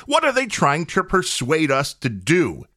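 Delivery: usual speaking voice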